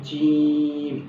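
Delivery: جیم with the long vowel stretched; careful recitation of جیم; drawn-out letter name. Shown as a demonstration. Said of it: "یہ اتنا کھینچنا ہے".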